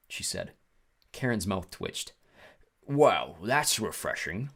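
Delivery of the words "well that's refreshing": slow drawn-out British character voice; British-like accent. A narrator's reading. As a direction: put on an odd, foreign-tinged British accent. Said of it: "He had a strange accent. British maybe".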